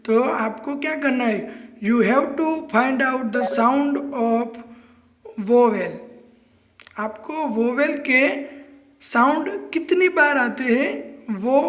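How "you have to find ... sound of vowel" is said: very slow, word by word; slow dictation. Shown as a demonstration. Teacher dictating the definition to students, very slow. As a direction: dictate it so they can write. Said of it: "teacher dictating rule".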